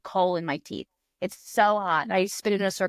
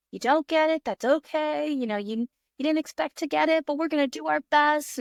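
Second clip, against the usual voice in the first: mirror pep talk; 6 semitones higher than usual. Retold or imitated. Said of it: "doing pep talk in the mirror".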